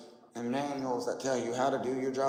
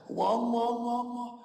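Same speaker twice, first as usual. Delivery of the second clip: higher, louder put-on Chinese accent on each wrong; mock Chinese accent; 10 semitones higher, 4 dB louder, slower than usual. Streamer mocking globers again in a put-on Chinese accent. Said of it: "let me do it in a Chinese voice".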